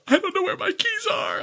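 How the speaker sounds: high, hammed-up fake wail; mock sob pleading with the parking officer; fake crying. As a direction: wail it like a helpless fake cry. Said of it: "fake crying".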